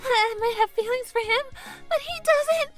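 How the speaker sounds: high, urgent fretting; high-pitched, worked-up lovesick panic; flustered panic. Acted. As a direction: fret aloud as your mind races. Panics over unreturned feelings.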